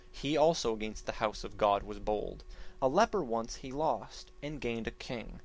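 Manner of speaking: ordinary delivery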